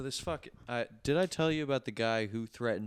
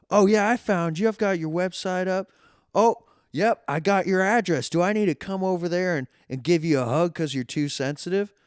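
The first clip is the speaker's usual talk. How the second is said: much higher, louder mocking taunt; taunting caller; 10 semitones higher, 10 dB louder than usual. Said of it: "He goes".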